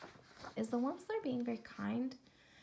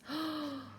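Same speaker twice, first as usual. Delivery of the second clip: gasp; 4 semitones higher, 5 dB quieter than usual. Acted. Reacting to sick barbaloots.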